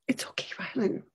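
soft unvoiced whisper to a child. Mom whispering to upset son in the mall. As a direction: breathe it gently to calm him. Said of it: "as I whispered".

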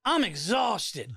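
drawn-out mock exhaustion; hammed-up tired worker sigh of a line; imitating weary counter woman. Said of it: "She just goes".

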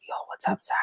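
breathy unvoiced whisper; imitating a friend's secret whisper. Tony whispering a greeting to Sarah. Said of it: "You don't have to whisper".

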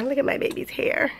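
soft breathy whisper. Real, not acted. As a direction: hush it to a whisper. Whispered then normal repeat.

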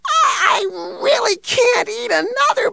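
high crying outburst. Acted. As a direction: wail it out, close to tears. Overfull dog confesses, near tears.

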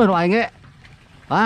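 ordinary delivery

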